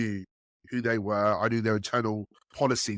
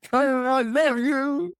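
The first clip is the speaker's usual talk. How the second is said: hammed-up lovestruck client, much higher, louder and very slow; ecstatic Italian client; 5 dB louder, much slower than usual. Italian procurement guy swooning over the signed deal, hammed-up. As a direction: stretch "I love you" out high and loud like a man in raptures. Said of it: "I won't do the accent".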